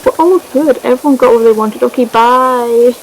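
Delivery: high, airy mock-cheerful goodbye; breezy dismissal. Acts Eleanor's breezy farewell.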